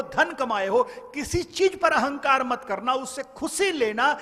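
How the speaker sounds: high, loud warning sermon; fiery preacher's admonition; preaching at full force. Acted. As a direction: thunder the warning about death at the crowd.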